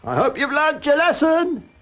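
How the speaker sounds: high, loud, slow victory crow; triumphant shout. A narrator's reading. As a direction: shout it down from the wall as the winner. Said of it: "he shouted after him".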